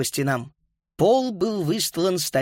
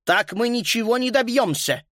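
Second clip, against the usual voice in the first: fed up, higher-pitched protest; mildly angry; 7 semitones higher than usual. A narrator's reading. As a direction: complain with a touch of anger. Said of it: "рассердился Карл".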